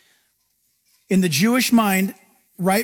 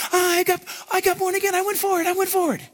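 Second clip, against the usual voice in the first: eager new convert; 9 semitones higher, faster than usual. Acted. New convert voiced joyfully.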